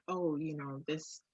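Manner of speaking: ordinary delivery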